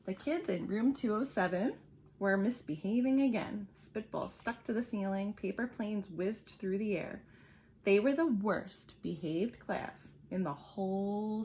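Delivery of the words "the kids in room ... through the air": ordinary delivery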